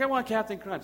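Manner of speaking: high-pitched imitation of a small child; demanding toddler. Daughter demanding cereal from dad, mock toddler demand. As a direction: mimic a little girl's breakfast demand.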